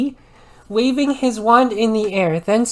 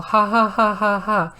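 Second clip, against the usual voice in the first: cackle. A narrator's reading. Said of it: "Dumbledore started to cockle".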